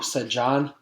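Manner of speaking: usual speaking voice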